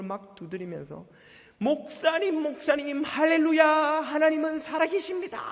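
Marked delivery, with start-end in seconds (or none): 1.6-5.4 high, loud overjoyed cries; joyful shouting. Retold or imitated. Deacon shouts at the pastor's door, ecstatic and loud. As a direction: bang on the door yelling the good news. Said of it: "하고 막 소리를 지르는 거예요".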